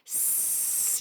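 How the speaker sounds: long unvoiced S hiss, like a snake; drawn-out s like a snake. Shown as a demonstration. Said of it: "that's what the letter S sounds like".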